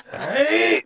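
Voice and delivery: high, loud "hai!"; comic martial-arts shout; mock samurai cry. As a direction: burst out with a dojo yell. Mimicking sword practice yell.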